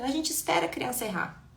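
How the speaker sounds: normal talking voice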